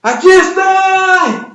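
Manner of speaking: high, drawn-out frog's shout; calling out. Acted. Trapped frog calls to its friends outside, calling out. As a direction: holler it out, stretching the last word. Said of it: "les grita la otra rana".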